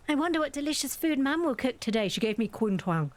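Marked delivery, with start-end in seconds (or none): 0.1-2.1 put-on high, bright little child wondering about dinner; child character voice. Streamer voicing the child in the game, cheerful. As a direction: read it like an eager kid.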